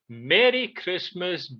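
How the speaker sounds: normal talking voice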